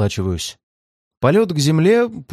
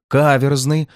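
ordinary delivery, nothing marked

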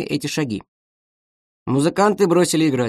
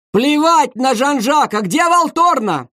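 high, slow, raised furious repeat; insisting louder on the missing horn; angry demand; 11 semitones higher, 4 dB louder, slower than usual. Acted. Conductor angrily repeating his demand to the orchestra.

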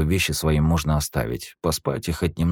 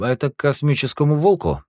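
high, louder tired cabbie; taxi driver; 12 semitones higher, 5 dB louder than usual. Acted. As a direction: ask like a weary cabbie.

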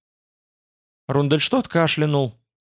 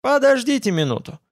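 higher-pitched, cocky assurance; boastful; 6 semitones higher than usual. A narrator's reading. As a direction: toss it out with light bravado. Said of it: "ответил он хвастливо".

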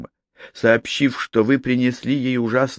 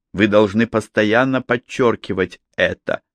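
ordinary delivery

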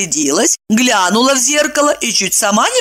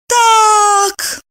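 high, drawn-out "so then"; summing up; 4 dB louder than usual. A narrator's reading. Evdokia sums up her friend's confession, high and pointed. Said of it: "подытожила Евдокия".

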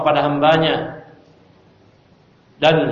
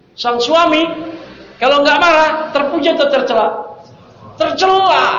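higher and louder, pressing the question; emphatic preaching; 10 semitones higher, 5 dB louder than usual. Real, not acted. Preacher presses the congregation about the betrayed husband, higher and louder. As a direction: demand the answer from the congregation.

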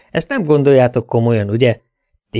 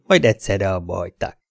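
ordinary delivery

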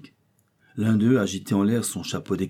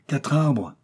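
exclaiming; 6 semitones higher than usual. A narrator's reading. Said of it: "s'écria-t-il".

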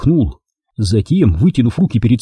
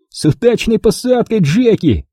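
high, gleeful jeering call; mocking shout; 10 semitones higher than usual. A narrator's reading. Wilson mocks Jack's crash landing, high jeering call. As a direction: crow it out, pitched well up. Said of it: "крикнул он".

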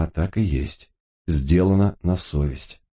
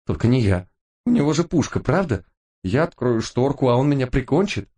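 frightened pleading; 8 semitones higher than usual. A narrator's reading. Terrified Gipa refuses the job.

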